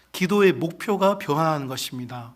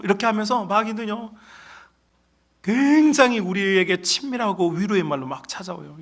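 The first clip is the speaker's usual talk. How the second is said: ordinary talk, nothing marked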